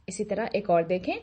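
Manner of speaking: ordinary talk, nothing marked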